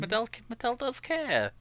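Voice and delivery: squeaky high-pitched sincere voice; high mock voice. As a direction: squeak it out like a cartoon. Mock tender repeat of the line.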